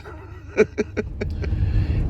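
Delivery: airy wheezing laugh; breathy laugh. Real, not acted. After his joke, an airy laugh. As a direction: let out a breathy chuckle.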